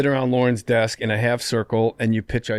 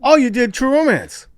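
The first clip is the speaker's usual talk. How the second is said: high, loud burst; excited reaction; 12 semitones higher, 5 dB louder than usual. Acted. Co-host reacts to the film credit, excited. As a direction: blurt it out in high, loud surprise.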